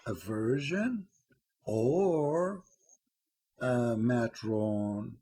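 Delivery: drawn-out slow mocking question; slow, pointed mock of the prophet's question. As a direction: stretch each word out like a mocking interrogation. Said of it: "He said".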